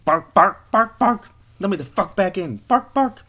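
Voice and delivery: loud, high-pitched talking dog; barking dog. Acted. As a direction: bark the words like an impatient dog. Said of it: "the dog starts barking".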